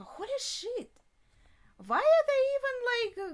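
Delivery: high-pitched, appalled exclamation; shocked outburst. Real, not acted. Viewer reacting to a girl being tortured, shocked. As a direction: cry out in disbelief, pitch soaring.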